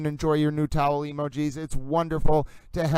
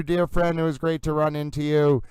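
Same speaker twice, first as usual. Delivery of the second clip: ordinary talk, nothing marked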